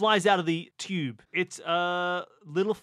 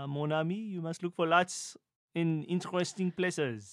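worldly stage-French advice; mock French accent; 4 dB quieter than usual. Player advising a teammate where to look, put-on French accent. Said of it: "speak like a, a Frenchman".

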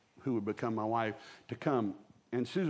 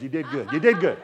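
mock congratulation; 8 dB louder than usual. Acted praise for engineer's guess.

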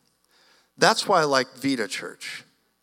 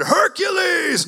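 much higher, louder, slow drawn-out yell; mock battle cry; 14 semitones higher, 5 dB louder, much slower than usual. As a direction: joke hero shout.

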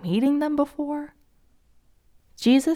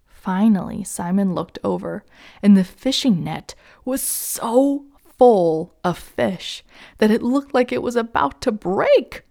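plain reading voice

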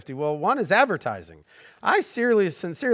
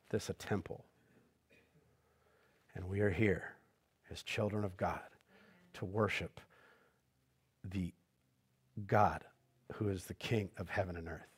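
hushed reverent preaching; 9 semitones lower, 15 dB quieter than usual. Acted. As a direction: lower the voice to speak of holy space.

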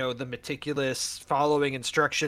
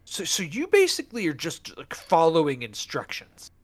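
skeptical wife; 4 semitones higher, 5 dB louder than usual. Retold or imitated. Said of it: "My wife goes".

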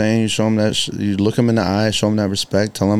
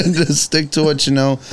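laughing; 6 semitones higher than usual. Real, not acted. Laughing repeat, slower.

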